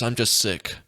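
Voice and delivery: normal talking voice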